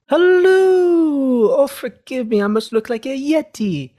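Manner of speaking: put-on very high, shrill old nanny greeting; flustered nanny apologising; Mrs Doubtfire impression. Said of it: "where she goes".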